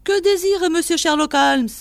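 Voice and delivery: high, loud inquiry; eager old keeper. A narrator's reading. Keeper asks what Sherlock Holmes wants, high and eager.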